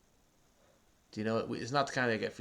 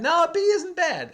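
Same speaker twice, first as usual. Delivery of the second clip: surprised; 7 dB louder, slower than usual. Real, not acted. Correcting child's guess.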